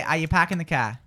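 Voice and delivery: New England accent, R's dropped. Shown as a demonstration. Host models a New England accent for co-host to copy. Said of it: "so I want you to say".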